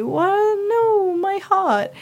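high, slow hammed-up anguish; mock heartbreak. A jilted player mock-wailing at Dorian, hammed-up heartbreak. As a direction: wail it out like a dramatic tragic heroine.

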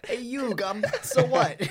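laughing through the words; loud laughing mock. Real, not acted. Mocking the title, laughing.

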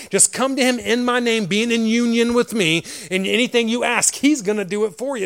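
high, loud preacher's promise; fervent exhortation. Acted. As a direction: urge the listeners on with fervor. Preaching in Jesus' voice.

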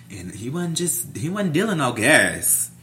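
ordinary talk, nothing marked

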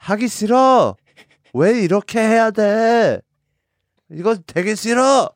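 high, fast whining complaint in Korean; 짜증 내는 아이처럼 높게 징징대며; whiny angry kid. Retold or imitated. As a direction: whine it like a kid refusing chores. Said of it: "How would you say it in Korean".

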